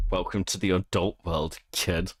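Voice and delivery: low mock tough-guy voice; deep mocking echo. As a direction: growl it back like a movie line. Repeats clip line mockingly.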